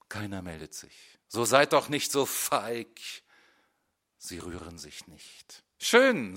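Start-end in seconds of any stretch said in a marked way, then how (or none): 1.3-3.0 sharp loud rebuke; louder, taunting them to speak up; scolding the class. A narrator's reading. Teacher scolding silent pupils, loud. 5.8-6.4 loud, high clipped word; curt outburst. A narrator's reading. Teacher rising from his chair, loud and curt. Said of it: "sage ich und erhebe mich".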